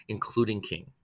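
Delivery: plain reading voice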